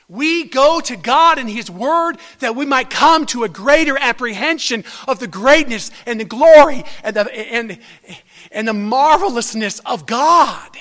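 rising, loud preacher's cry; swelling pulpit climax; preaching at full force. Acted. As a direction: build the praise to a loud peak. Preaching climax on God's glory.

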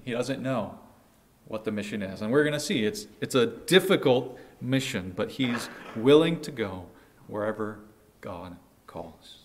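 ordinary talk

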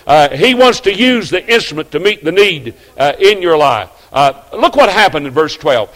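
full-force pulpit delivery; preaching at full force. Acted. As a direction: preach the discouragement out to the pews. Sermon climax.

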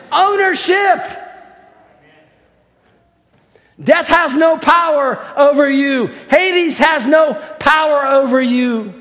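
ringing, fervent pulpit preaching; preaching at full force. Acted. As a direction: proclaim it to the whole church with fire. Preaching to the congregation.

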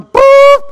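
sudden high, loud "boop"; imitated beep. Passing through airport security, loud imitated beep. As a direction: mimic a scanner alarm, held high.

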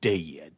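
a solemn low dead; dead dropped low. Shown as a demonstration. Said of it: "here we go".

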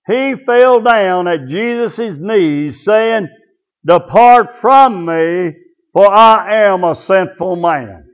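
slow, drawn-out scripture reading; slow emphatic reading. Real, not acted. Preacher reading Peter's confession to the church, slow and emphatic. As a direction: stretch out each phrase of the verse. Verse read out slowly for emphasis.

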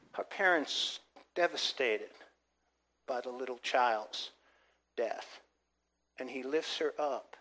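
very soft, low, with weighty pauses; hushed and solemn. Real, not acted. Preacher telling of the little child's death, hushed. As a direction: let the voice sink almost away.

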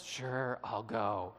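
low, resigned acted reply; grudging agreement. Jonah giving in to God after the fish, grudging. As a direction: give in reluctantly, dropping the pitch.

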